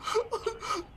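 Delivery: high squeaky laughing whine; laughing disbelief. Real, not acted. Gamer cracking up at the broken game. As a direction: squeal it through helpless laughter.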